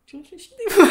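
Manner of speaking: words breaking into a high laugh. Real, not acted. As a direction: tease him while cracking up. Teasing partner, bursts out laughing.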